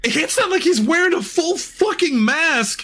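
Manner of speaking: getting carried away, voice climbing; too animated. Real, not acted. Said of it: "I'm getting too animated".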